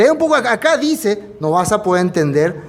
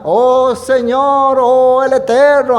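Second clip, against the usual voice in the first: drawn-out, higher, hollow "Oh Señor", a showy lip-service prayer; mock pious prayer; 5 semitones higher, 4 dB louder, much slower than usual. Preacher mimicking hypocrites calling on God, slow and high. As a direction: pray it slow and high like empty devotion. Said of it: "así, ¿no? Habla".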